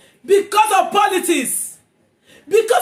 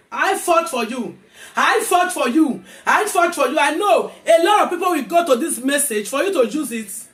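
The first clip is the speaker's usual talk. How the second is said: ordinary delivery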